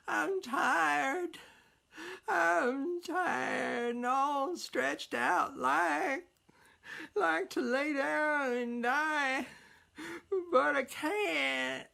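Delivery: put-on high, slow, weepy old woman's lament; crying old woman. Old woman with canes tells Jack she is tired, crying.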